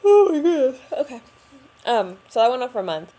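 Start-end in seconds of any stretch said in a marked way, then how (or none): 0.0-1.2 high, loud choked gasp, near tears; emotional break. Real, not acted. Vlogger breaks down recalling the hospital month, choked up. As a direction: catches herself as emotion hits. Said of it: "suddenly overwhelmed on camera".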